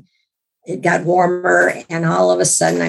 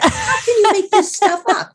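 high-pitched, incredulous laughing question; amused disbelief, voice shooting up high; 13 semitones higher than usual. Retold or imitated. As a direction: laugh right through the question. Laughing at priest's explanation.

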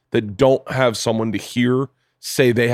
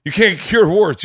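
exasperated doctor; 7 semitones higher, 5 dB louder, slower than usual. Retold or imitated.

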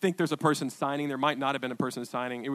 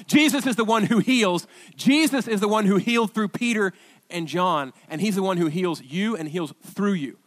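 building preacher's refrain, louder and higher; emphatic preaching; 3 semitones higher, 5 dB louder than usual. Real, not acted. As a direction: proclaim it in driving, repeated waves.

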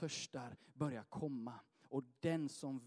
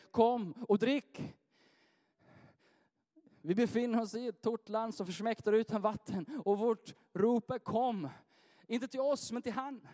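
high, loud, urgent sermon delivery; full-force preaching; 7 semitones higher, 7 dB louder than usual. Acted. Pastor rousing the congregation to seek more, full force. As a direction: drive it home to the congregation.

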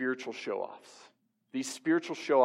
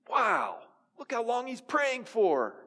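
much higher, gushing mock admiration; amazed onlooker; 12 semitones higher than usual. Onlooker marvels at the hypocrite's long prayer, high and gushing. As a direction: play an impressed bystander, wide-eyed. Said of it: "they'd be amazed".

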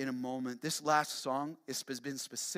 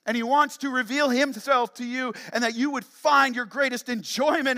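loud, high, full-force sermon; impassioned preaching; 9 semitones higher, 14 dB louder than usual. Real, not acted. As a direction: preach it loud and urgent, voice raised.

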